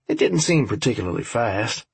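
ordinary delivery, nothing marked

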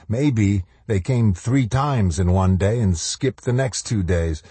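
ordinary delivery, nothing marked

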